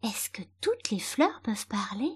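whispered, hesitant question; almost voiceless little murmur; timid murmur. A narrator's reading. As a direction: ask it shyly, barely above a breath. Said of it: "d'une voix toute timide, presque réduite à un murmure".

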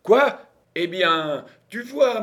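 high, exclaimed crowing; triumphant. A narrator's reading. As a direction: flaunt your victory at the doubter. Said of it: "avec une indescriptible expression de triomphe".

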